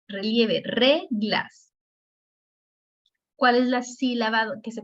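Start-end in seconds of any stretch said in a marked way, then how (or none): 0.6-1.7 reglas with a strong first syllable, falling after; stress on RE. Shown as a demonstration. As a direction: punch RE in reglas, let GLAS drop. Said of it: "se pronuncia con mayor relieve". (reglas con énfasis en la primera sílaba)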